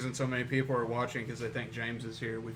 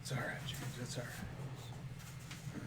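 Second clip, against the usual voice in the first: hushed, breathy reassurance to the standee; whisper; 12 dB quieter, slower than usual. Pretend. Comforting the cardboard James cutout in a whisper. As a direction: whisper it softly and slowly. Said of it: "he keeps whispering during the show".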